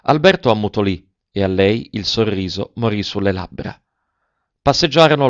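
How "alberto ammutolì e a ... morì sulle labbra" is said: ordinary delivery, nothing marked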